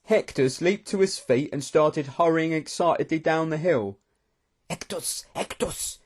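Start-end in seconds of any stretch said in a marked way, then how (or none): 4.7-6.0 hushed, urgent hiss; furious whisper. A narrator's reading. Ramcarn calling back hurrying Hector, furious whisper. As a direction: call the name softly but crossly. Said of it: "Ramcarn said in a furious whisper".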